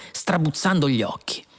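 usual speaking voice

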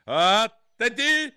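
high, loud, drawn-out command; shriek. A narrator's reading. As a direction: shriek it like a drill order. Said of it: "strillò la regina". (strillo acuto)

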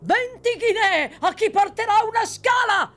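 very high, loud, sustained yell; overpowering shout. A narrator's reading. Horseman calls to the mob below the window, out-shouting everyone. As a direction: shout over every other voice in the crowd. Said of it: "gridò sotto la finestra con una voce tale da".

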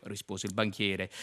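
ordinary talk

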